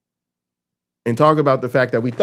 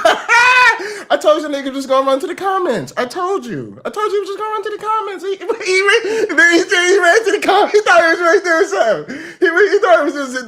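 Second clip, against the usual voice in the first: laughing; 13 semitones higher, 4 dB louder than usual. Retold or imitated. Billionaires cackling at the public.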